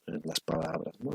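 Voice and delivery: usual speaking voice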